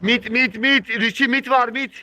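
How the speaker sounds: high, loud urgent callout; excited shouting. Real, not acted. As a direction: yell the warning fast.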